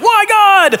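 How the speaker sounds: exasperated cry. Retold or imitated. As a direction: throw up your hands and wail. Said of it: "And you're like".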